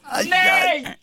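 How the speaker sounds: high, hammed-up cry of despair; mock wail. Host wails at the butter news, mock despair. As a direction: wail like the world is ending.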